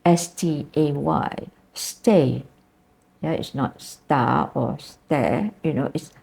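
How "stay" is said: "stay" with a gliding ay diphthong. Shown as a demonstration. Teacher to students showing the diphthong, gliding "stay". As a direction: let "stay" dip from e to i. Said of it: "English has diphthongs".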